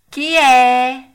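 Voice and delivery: high, loud little call from inside; a tiny voice answering the knock. A narrator's reading. The cricket calling from inside the hut, tiny voice. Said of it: "disse una vocina di dentro".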